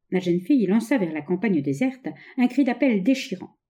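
usual speaking voice

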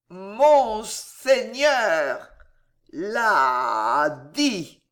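very slow slurred threat; drunk Gillot. Acted. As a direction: drag each syllable out drunkenly. Drunk nephew threatens uncle.

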